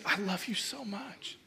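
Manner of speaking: very soft, hushed loving words; tender declaration. Retold or imitated. Wife embracing her husband, tender hushed love. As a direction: breathe it gently, imitating a loving wife.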